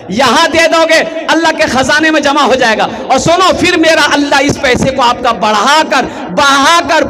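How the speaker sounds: ringing oratory rising to a high peak; full-force preaching. Real, not acted. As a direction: proclaim the promise to the whole crowd. Preaches the promised reward. (जोशीली तक़रीर, बुलंद आवाज़ में)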